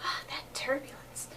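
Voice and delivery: breathy hushed whisper. Retold or imitated. Whispering in awe at the flight attendants.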